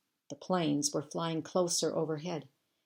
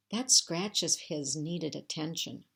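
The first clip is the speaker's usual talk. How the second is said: ordinary delivery, nothing marked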